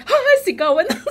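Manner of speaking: high, loud gasp into a laughing cry; retold fangirl outburst, high and loud; excited screaming. Fan retelling seeing the Hawaii lineup, excited screaming. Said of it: "I was screaming".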